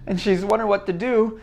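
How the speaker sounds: usual speaking voice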